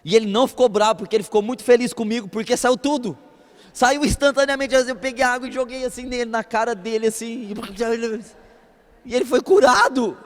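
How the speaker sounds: loud, fired-up preaching climax; shouting the miracle to the congregation; preaching at full force. Acted. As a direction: proclaim the healing with rising fervor.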